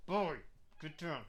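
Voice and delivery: through gritted teeth. Real, not acted. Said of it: "The gritted teeth voice".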